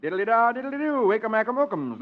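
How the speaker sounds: high, chanted nonsense spell; magic words. Acted. Host chants magic words for the little girl, playful. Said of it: "Magic words for the music".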